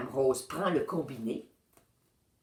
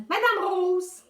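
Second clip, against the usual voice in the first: high, louder call; 6 dB louder, slower than usual. A narrator's reading. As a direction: call her name again. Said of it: "appelle-t-il".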